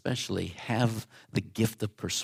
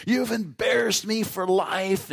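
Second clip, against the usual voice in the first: put-on teenage scream; 10 semitones higher than usual. Teen daughter screaming at her father, high wailing shout. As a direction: wail it like a humiliated teen. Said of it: "screaming at my dad and my grandfather".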